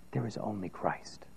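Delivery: soft, hushed whisper. Real, not acted. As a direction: drop to a whisper for the key truth. Quiet climax of sermon.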